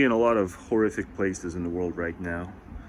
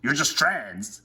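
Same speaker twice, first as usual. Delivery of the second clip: scornful mock; 5 semitones higher, much slower than usual. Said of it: "proggy people just went".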